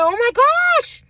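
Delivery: very high, loud shocked cry; shocked shriek. Retold or imitated. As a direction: shriek in alarm. Nurse sees the blood.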